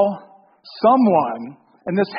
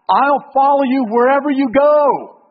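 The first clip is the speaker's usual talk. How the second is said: higher, louder declaration of devotion, acted out; eager pledge; 6 semitones higher, 5 dB louder than usual. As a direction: play an over-eager follower calling out his vow. Acts out eager would-be follower.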